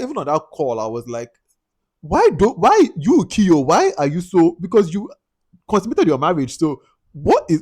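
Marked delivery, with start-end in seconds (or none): 2.0-7.6 higher, louder, slow halting disbelief; incredulous demand. Real, not acted. Vlogger confronting the husband, slow, loud and incredulous. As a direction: sputter at him in disbelief. Imagined challenge to Kiyo.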